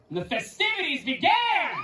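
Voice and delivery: announcing. A narrator's reading. Narrator hailing the feast, excited announcement. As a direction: exclaim it with a grin.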